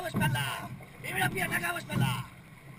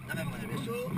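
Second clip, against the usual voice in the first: breathy whisper, much softer; 7 semitones lower, 7 dB quieter than usual. Acted. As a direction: drop to a quick whisper. Coach reminding rowers mid-workout.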